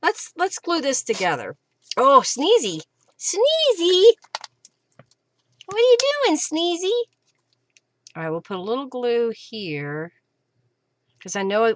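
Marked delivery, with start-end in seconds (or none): 3.2-4.0 calls the puppy's name, high; high calling to puppy. Real, not acted. Crafter calls her puppy Sneezy, high. 5.7-6.9 puppy talk. Real, not acted. Crafter asks her puppy what he's up to, high cooing.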